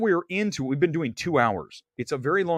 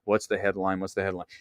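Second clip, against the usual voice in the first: lower, softer sleepy muttering; half-asleep in bed, thinking aloud; groggy self; 8 semitones lower, 5 dB quieter than usual. Retold or imitated. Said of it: "this is me in my bed going".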